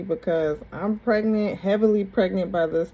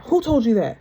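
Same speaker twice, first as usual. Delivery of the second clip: imitating an angry demand, raised and louder; mock-angry challenge, pitched up; mad; 7 semitones higher, 6 dB louder than usual. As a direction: act out someone getting mad. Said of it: "Then he would get mad".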